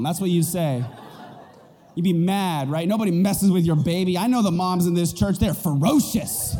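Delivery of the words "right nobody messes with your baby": usual speaking voice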